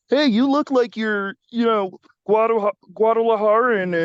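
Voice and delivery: a hint of a clueless asker; mock stranger. To the nosy stranger, a touch of mock asker voice. Mocking people who ask his race.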